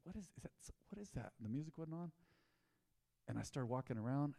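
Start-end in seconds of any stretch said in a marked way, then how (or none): 0.0-1.3 puzzled murmur. Retold or imitated. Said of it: "I said".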